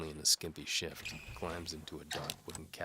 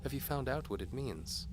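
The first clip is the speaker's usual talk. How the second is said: seductive noblewoman; 8 semitones higher than usual. A narrator's reading. Antimony dares the knight to follow. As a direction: tease the knight like a bold noblewoman.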